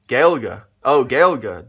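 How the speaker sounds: Gaelga with a hard G and a clear vowel at the end; Gaelga, carefully pronounced; Afrikaans pronunciation. Shown as a demonstration. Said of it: "perfect pronunciation with the E at the end".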